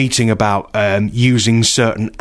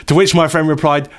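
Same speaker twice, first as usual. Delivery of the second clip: usual speaking voice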